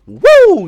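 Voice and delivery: loud, high whoop; excited woo. Real, not acted. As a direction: let out a hype cheer. Host cheers.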